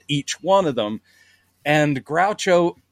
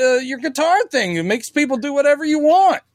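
much higher, stilted actor read; mock stiff TV line; 10 semitones higher than usual. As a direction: deliver it like a fumbling actor forcing the line out, pitched high. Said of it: "So you're, uh".

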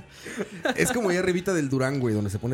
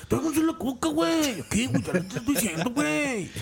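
high-pitched mock norteño Mexican accent; bossy TV narco, hammed-up northern Mexican accent; exaggerated norteño accent, pitched way up; Diego Luna; 9 semitones higher than usual. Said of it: "acento de norteño mexicano".

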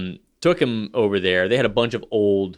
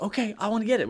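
put-on higher, eager little-kid voice; imitating eager kid; 9 semitones higher, 5 dB quieter, faster than usual. Dad imitates his son grabbing the toy with his own money, eager kid voice. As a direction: play the son, keen to buy it himself.

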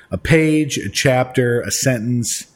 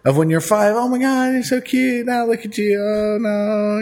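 put-on high, gushing baby-talk coo; cooing at a baby photo; 10 semitones higher than usual. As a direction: gush over a cute old photo.

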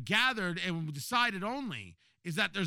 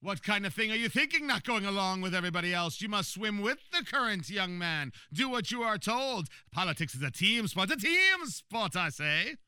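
hammy supervillain pronouncing judgment; evil genius voice; 2 semitones higher, 4 dB louder than usual. Retold or imitated. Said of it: "that's my evil genius voice".

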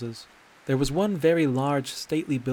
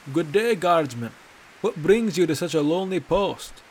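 higher and louder, a bold hail to the guard; hearty greeting; 5 semitones higher, 6 dB louder than usual. Acted. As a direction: call out cheerfully as you approach. Bracknell hails the guard.

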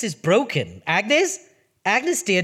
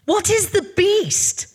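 exasperated; 10 semitones higher, slower than usual. Acted. Exasperated host.

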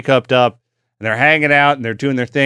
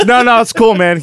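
lightly voiced, higher pleading tone; smooth it over, pitched up; mock reassuring bandmate; 7 semitones higher, 3 dB louder than usual. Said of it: "so Ray's like".

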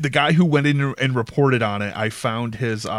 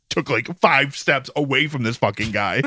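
usual speaking voice

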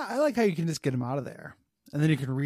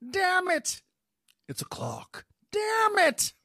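frustrated villain; 3 dB louder, slower than usual. Acted. Riddler frustrated at the riddle.

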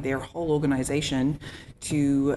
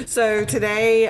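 higher, louder, slow eager start; 8 semitones higher, 7 dB louder, slower than usual. Real, not acted. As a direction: launch in with enthusiasm. Eager start after volunteering.

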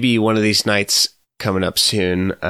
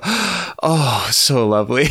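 gasp, then a higher, swooning "oh"; rapturous professor; 4 semitones higher, slower than usual. Retold or imitated. Student imitates professor moved by Mamma Mia, rapturous gasp. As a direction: imitate someone swooning with delight. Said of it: "put his hand to his heart and just go".

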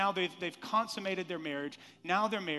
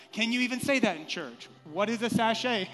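mock scandalised; 4 semitones higher, 7 dB louder than usual. Mock shock at racy scripture.

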